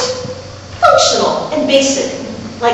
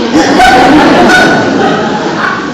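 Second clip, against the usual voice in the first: laughing; 8 semitones higher, 5 dB louder than usual. Real, not acted. Laughing at own story.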